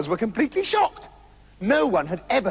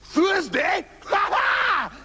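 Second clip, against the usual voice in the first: higher, shouted gleeful cry; triumphant loud laugh; 11 semitones higher, much slower than usual. A narrator's reading. Trousers crows loudly on learning it is Thursday. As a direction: crow with delight at the news. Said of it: "began to laugh loudly".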